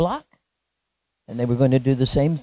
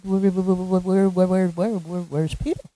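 rapid-fire repeated babble on one pitch; mock panicked guards tripping over the question; flustered stammering. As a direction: stutter the first word over and over in confusion. Guards acted, baffled at missing prisoner.

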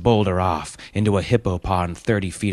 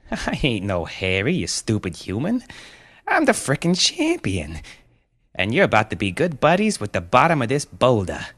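put-on cocky boy villain, jumping high in pitch; gloating bratty champion; 5 semitones higher than usual. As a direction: sneer like a kid supervillain with a chuckle.

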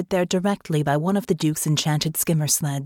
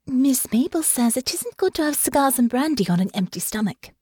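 put-on gentle, higher, firm young lady; calm but firm; 7 semitones higher than usual. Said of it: "keep her voice calm and gentle while still being firm".

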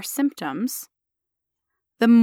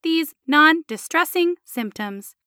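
slow, deliberate robot delivery; 5 semitones higher than usual. Acted. Patient reporting neutrally and factually to the doctor, robotic voice. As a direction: report it like a machine, syllable by syllable. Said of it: "I'll try it again as a robot".

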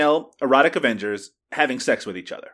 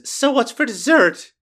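higher-pitched, drawn-out quoted line; lightly voiced doll character; 9 semitones higher, 3 dB louder, slower than usual. Host quoting an Avenger surprising the thief, lightly acted slow higher voice. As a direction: put on a slow, raised voice for the quote. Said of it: "comes up behind him and says".

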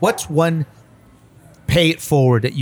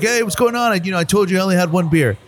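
lightly voiced Chuck; 7 semitones higher than usual. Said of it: "He's like".

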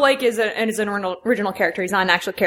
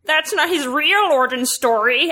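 high nasal nerd whine; whiny fanboy complaint; 6 semitones higher, slower than usual. Shown as a demonstration. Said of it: "my nerd voice sounds like Snarf".

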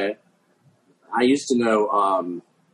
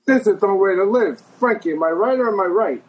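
higher, indignant wiseguy rant; the gangster ranting again, pitched up; 9 semitones higher than usual. Retold or imitated. Retelling the gangster's rant to the hosts, high indignant wiseguy voice. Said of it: "he would do the voice".

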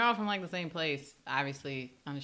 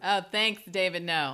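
loud, higher, over-projected thanks; exaggerated loud voice; 4 semitones higher, 10 dB louder than usual. Guest told to speak louder thanks the host, exaggeratedly loud. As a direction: jokingly overdo the volume. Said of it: "speak in your normal voice".

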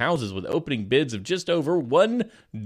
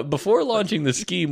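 normal talking voice

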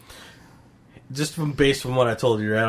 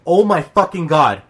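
loud, higher-pitched outburst of disbelief; exasperated disbelief; 4 semitones higher, 8 dB louder than usual. Retold or imitated. Reader reacting to the comic's last scene, exasperated disbelief. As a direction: exclaim it loudly, appalled.

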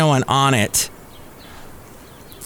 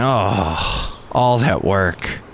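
low, slow, deflated groan; low, slow, mock despair; mock inner groan; 6 semitones lower, much slower than usual. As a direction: sigh it out privately. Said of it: "in the back of their minds they're going".

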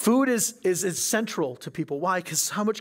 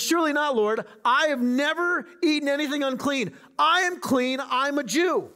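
much higher, insistent protest; indignant Peter; 8 semitones higher, 4 dB louder than usual. Acted. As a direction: Peter pleading his purity, pitched high and pushing. Peter protests third time.

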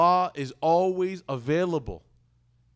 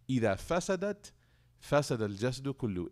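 recitation; 2 semitones lower, 5 dB quieter, much faster than usual. Acted. Reciting hadith in Arabic.

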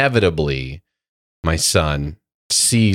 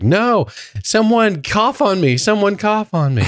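high, pleading mock plea; pleading Phoebe. Retelling Phoebe begging for her cold back, high and pleading. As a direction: beg to be coughed on, voice higher. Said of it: "her voice gets better and she's like".

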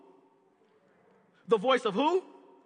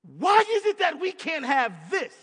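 louder, higher, indignant; indignant demand; 4 semitones higher, 6 dB louder than usual. Retold or imitated. Adam and Eve complaining to God, loud and indignant. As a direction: demand it like it's unfair. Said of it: "they said".

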